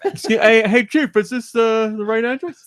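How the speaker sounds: high, loud mock hailing; delivery guy calling out. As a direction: play a driver hailing a silent statue. Acting delivery guy addressing the statue.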